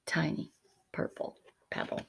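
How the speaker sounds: usual speaking voice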